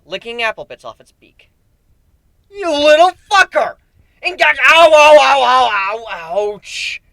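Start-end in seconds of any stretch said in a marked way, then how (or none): 2.5-3.8 slow, loud, high furious yell; furious shout. Acted. Griffin yelling at the bird who ate his food, furious. 4.2-7.0 loud high ow ow that fades; yelping in pain. Acted. Griffin clutching his aching head, pained yelps fading. Head hurts after yelling.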